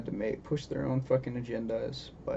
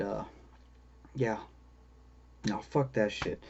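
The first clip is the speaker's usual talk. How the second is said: ordinary talk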